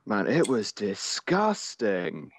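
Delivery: very slow, drawn-out "disgusting"; appalled emphasis. Real, not acted. Friend reacting to the GameSpot backlash, slowly appalled. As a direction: stretch the words out in disgust.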